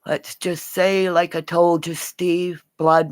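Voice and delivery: ordinary talk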